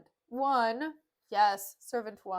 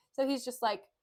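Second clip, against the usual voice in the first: ordinary talk, nothing marked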